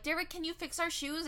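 high-pitched sing-song asking; imitated girlish request. Sisters asking the shoemaker for help, imitated pleading. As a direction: ask sweetly as a chorus of sisters, high.

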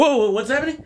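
high-pitched mock alarm; haters. Podcaster imitating comedian's haters, mock alarm. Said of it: "your haters are gonna be like".

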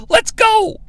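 high-pitched "Let's go." to end the speech; mock Russo. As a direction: jump way up for "Let's go.". End of Russo's pep talk.

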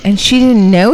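normal talking voice

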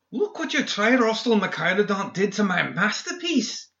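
high, sneering sarcastic voice. A narrator's reading. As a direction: drip with mock outrage. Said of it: "McMorning said sarcastically".

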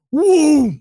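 high, loud "woo" for a swing; imitated punch whoosh. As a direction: act out a sudden punch with your voice. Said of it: "this one girl just".

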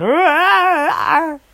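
sudden high shriek; startled scream. Acted. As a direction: yelp in fright, loud. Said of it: "so he went".

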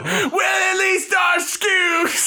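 very high, slow British yell; hammed-up British screech, drawn out; mock British shriek. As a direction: scream the taunt in an absurd British accent. Repeats mock British taunt, shouted.

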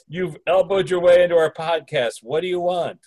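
ordinary delivery

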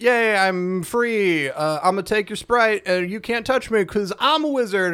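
lightly hammed-up smug wizard. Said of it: "he's like".

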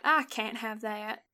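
repeated Southern twang. Shown as a demonstration. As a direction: draw it out in a Southern accent. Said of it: "I went southern for that".

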